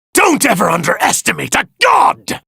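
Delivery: furious god shout. Acted. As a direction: scream it as an insulted god. Villain enraged at opponent.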